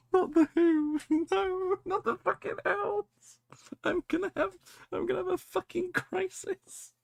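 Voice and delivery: squeaky high, breathless laughter through the words; can't stop laughing while reacting to the picture; laughing fit. Real, not acted. Laughing fit over a funny picture of the Elves.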